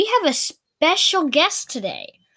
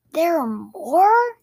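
surprised; 5 semitones higher, 4 dB louder, slower than usual. Acted. Character alarmed thought.

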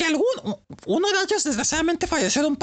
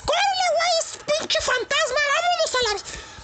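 much higher frightened call to run; panicked mock yell; 9 semitones higher than usual. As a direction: shout at friends to flee a ghost. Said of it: "mis compas". (los amigos gritan asustados que corran)

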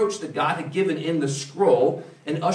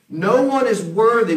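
louder, higher and slow, each word pressed; emphatic declaration; 5 semitones higher, 6 dB louder, slower than usual. Real, not acted. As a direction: pound home that no one is worthy. Preacher driving home his point.

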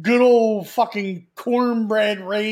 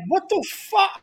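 high, startled what the fuck; pitched-up stunned reaction; shocked outburst; 11 semitones higher than usual. Retold or imitated. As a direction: blurt it out in disbelief. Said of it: "I'm like".

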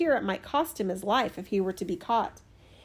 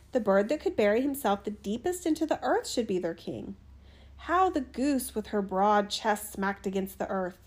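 ordinary delivery, nothing marked

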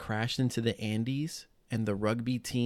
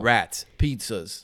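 very slow, loud hammed-up New York accent; 7 dB louder, much slower than usual. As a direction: drawl it like a proud New Yorker. Keeps doing New York accent.

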